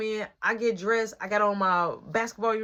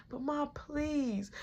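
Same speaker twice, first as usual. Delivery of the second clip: soft, slow begging; pleading; 5 semitones higher, 11 dB quieter, much slower than usual. Retold or imitated. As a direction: plead quietly with mom. Begging mom to go.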